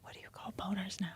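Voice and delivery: barely audible whisper; faint, hushed ASMR question. Pretend. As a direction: whisper very softly into the mic. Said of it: "do a little E S M R".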